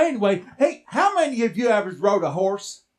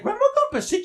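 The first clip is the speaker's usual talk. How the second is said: surprised echo; much faster than usual. Retold or imitated. Boy echoing mama's news, a high surprised question. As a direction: pitch jumps high on the question.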